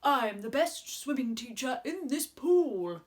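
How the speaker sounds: slow, puffed-up frog voice; proud frog. Acted. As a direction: boast like a self-important frog. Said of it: "The frog puffed himself up importantly".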